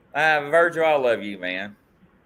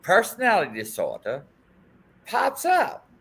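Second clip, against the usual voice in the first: reading a viewer comment; 6 semitones higher than usual. Retold or imitated. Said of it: "it says".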